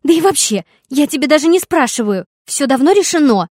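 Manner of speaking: put-on loud high furious girl; yelling. A narrator's reading. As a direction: shout it down. Daughter still yelling. (крик)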